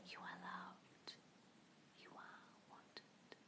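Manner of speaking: slow, breathy whispered affirmation; ASMR whisper. Shown as a demonstration. Demoing a soothing ASMR whisper for viewers. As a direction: whisper it softly like an ASMR video. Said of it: "Like, ones like that".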